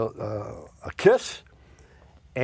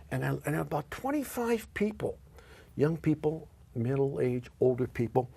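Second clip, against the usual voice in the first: ordinary talk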